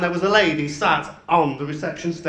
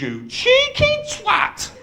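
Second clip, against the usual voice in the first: mock indignant retort; slower than usual. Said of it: "She says".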